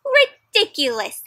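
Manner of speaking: high, loud, slow scornful teacher; teacher scoffing at late pupils, scornful; stern teacher. Acted. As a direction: dismiss it with scorn. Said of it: "said Miss Gernsblot".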